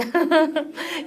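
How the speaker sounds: short breathy chuckle; amused chuckle. Real, not acted. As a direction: chuckle at the wrong option. Amused after posing wrong option.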